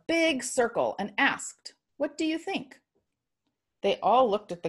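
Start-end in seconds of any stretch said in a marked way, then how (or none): 2.0-2.8 put-on high and soft, little girl God; high gentle God voice. A narrator's reading. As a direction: ask it softly, like a child. God asks the humans gently.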